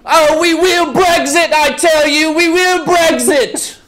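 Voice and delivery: hammed-up British accent, high and loud; mock British accent. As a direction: play a fired-up Brit vowing to Brexit. Mock Brits quarrel over Brexit.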